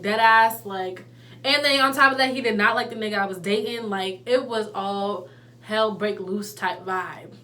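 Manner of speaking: ordinary talk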